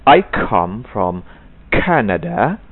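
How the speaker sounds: "I come from Canada" slow, k bursting; careful "Canada" with a popped k; aspirated k. Shown as a demonstration. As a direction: model sentence slowly. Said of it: "tidak Kanada".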